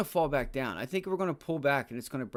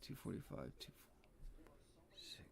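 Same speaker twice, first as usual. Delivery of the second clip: whisper; 3 semitones higher, 21 dB quieter than usual. Real, not acted. Reading prices under his breath.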